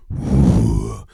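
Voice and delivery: loud wheezy exhale; heavy breath out from a congested chest; noisy chest breath. Shown as a demonstration. Comic on antibiotics showing off his chesty breathing, noisy exhale. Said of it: "I can hear my breathing".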